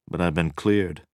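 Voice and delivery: ordinary delivery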